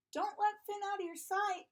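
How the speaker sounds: high-pitched motherly call; calling after them. A narrator's reading. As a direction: call a warning after departing children. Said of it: "Missus Munch called after them".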